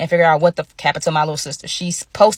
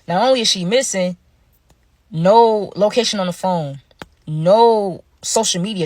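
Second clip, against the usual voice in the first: ordinary talk, nothing marked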